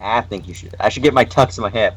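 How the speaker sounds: normal talking voice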